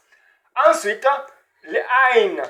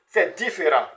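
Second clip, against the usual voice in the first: usual speaking voice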